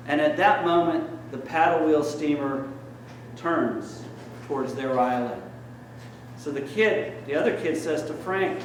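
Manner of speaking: ordinary talk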